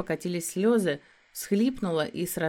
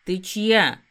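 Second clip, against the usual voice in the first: a near growl, louder; almost growled; 8 dB louder than usual. Acted. Werewolf demands whose she is, almost growling. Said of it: "почти прорычал он".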